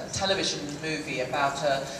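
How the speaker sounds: ordinary delivery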